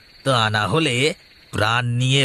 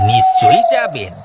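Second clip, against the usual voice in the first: mocking sneer; 7 dB louder than usual. Dacoit sneers at leaving request.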